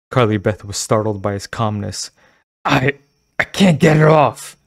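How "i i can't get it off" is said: louder, higher, stumbling panic; sputtering. Acted. Girl sputtering to the store owner, panicked. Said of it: "she sputtered".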